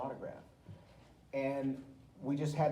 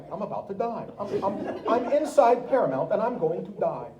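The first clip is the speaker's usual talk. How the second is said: mock panic; 8 semitones higher, 9 dB louder than usual. Tony's retold panic.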